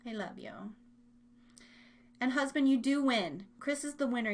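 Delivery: ordinary talk, nothing marked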